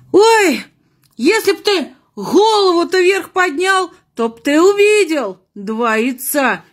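high, coy exclamation then reveal; coy high nun. Acted. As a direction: gasp coyly, then spill it. The nun's punchline.

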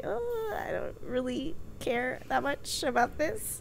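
high, soft, whiny mock boredom; bored whine. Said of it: "I was like".

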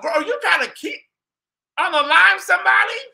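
high, loud disbelieving yell; incredulous shout. Acted. Vlogger shouts at Jackie about the salty roast, in loud disbelief. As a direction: cry out in shock at her cooking. Scolding Jackie over the salt.

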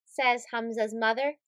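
ordinary delivery

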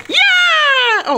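loud, high, drawn-out yell; real squeal of excitement; excited shriek. Real, not acted. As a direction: scream a triumphant yes. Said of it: "ik zal niet zo hard gillen".

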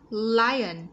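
ordinary talk, nothing marked

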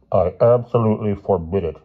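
loud, stern headmaster refusal; firm and emphatic. A narrator's reading. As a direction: forbid it firmly, in a weighty voice. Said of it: "he said firmly".

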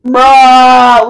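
loud, held-out mock protest; drawn-out bruh. Kid answering pizza guy's tip demand, drawn-out bruh. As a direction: stretch one word out loudly on a level pitch. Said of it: "and then I'm like".

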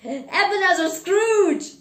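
high, loud shout of a name. A narrator's reading. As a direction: shout it out, pitch jumping up. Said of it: "shouted the man".

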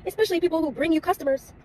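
telling viewers the salon visit left her upset, loud and high. Real, not acted. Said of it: "I got so upset".

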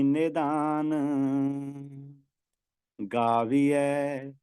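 drawn-out sacred chant, every word held long; slow devotional recitation. Acted. Teacher reciting the pauri for students, slow devotional chant. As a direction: intone the verse slowly and reverently.

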